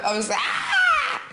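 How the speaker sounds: put-on high drawn-out baby wail; baby scream. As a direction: wail like a newborn.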